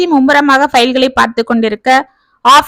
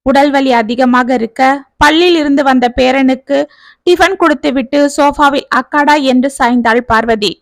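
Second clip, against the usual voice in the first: ordinary delivery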